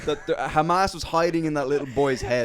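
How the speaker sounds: high, loud, hammed-up official declaration; mock official justification. Comic riffing as a spokesman justifying an attack, mock official justification. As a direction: proclaim it loudly as a parody spokesman.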